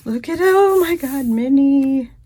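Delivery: high, loud gush over a cute sticker; thrilled fan spotting Minnie; delighted squeal. Real, not acted.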